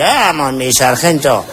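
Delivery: put-on high, slurred drunk begging the sergeant; drunk man. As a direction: ham up a pleading drunk. (borracho pidiendo al sargento)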